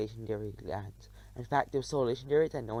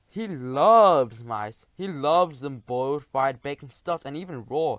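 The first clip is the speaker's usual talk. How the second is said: much louder, very slow gloating boom; dragon crying out; 9 dB louder, slower than usual. A narrator's reading. Dragon gloating to the mouse, loud slow cry. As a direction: savour each cooking word loudly. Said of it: "he cried".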